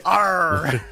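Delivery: a laughing pirate growl; pirate arr. Acted. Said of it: "our friend, the pirate".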